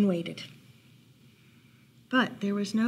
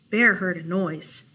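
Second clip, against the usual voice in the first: ordinary delivery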